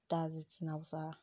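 ordinary delivery

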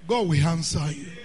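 ordinary talk, nothing marked

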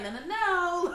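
playful impression of a raspy voice refusing to go high; imitating a cracking voice. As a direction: play your own voice saying no. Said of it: "my voice was like".

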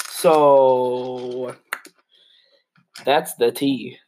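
a lightly put-on gossip voice; sassy gossip. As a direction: drop the tea like a gossip.

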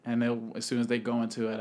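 ordinary talk, nothing marked

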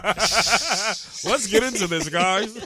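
slow, drawn-out host voice, laughing; mock game show host. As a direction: drag it out like a TV host, cracking up. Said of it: "Your game show host voice kills me".

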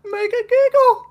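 very high, loud squeaky voice; silly voice. Acted. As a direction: squeal it like a cartoon sprite. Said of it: "anytime I do a voice".

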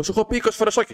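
high-pitched retold complaint; acting out a fed-up customer; exasperated scolding. Customer scolding a returning peddler, exasperated.

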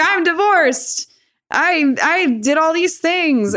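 lightly lampoon a smug divorced father; mocking dad. Mimicking her newly divorced dad.

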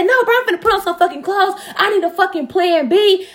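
high-pitched, worked-up retort; heated refusal. Retold or imitated. Retelling her reply in the argument, heated refusal. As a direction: argue back urgently, pitched high. Acts out arguing back at partner.

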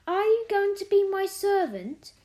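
high, loud, imperious little mistress. A narrator's reading. Mary asking the maid about being her servant, imperious. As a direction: ask it as if owed service. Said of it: "still in her imperious little Indian way".

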